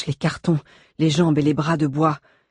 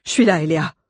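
calling out; 4 dB louder, faster than usual. A narrator's reading. Rescuer calling to trapped Eléa.

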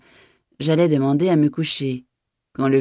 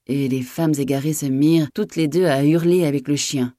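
ordinary delivery

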